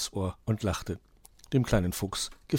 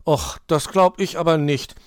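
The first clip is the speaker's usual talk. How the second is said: loud, high-starting grumble of disbelief; Borstel grumbling; 5 semitones higher, 7 dB louder than usual. A narrator's reading. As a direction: balk sulkily at the idea. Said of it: "maulte Borstel".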